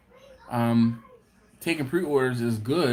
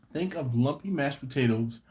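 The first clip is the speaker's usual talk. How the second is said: ordinary delivery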